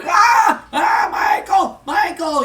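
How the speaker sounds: high, wailing mock agony; screaming in pain. Vader holds his broken ankle, high pained screaming. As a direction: cry out loud, clutching a hurt ankle, easing off at the end. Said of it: "screaming out in pain".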